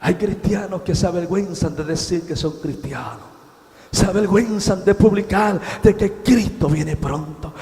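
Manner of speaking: ordinary talk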